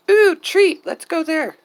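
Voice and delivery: high excited voice for the hens; squeaky voicing of a happy hen; chicken thoughts voice. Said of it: "get that sound into their heads of".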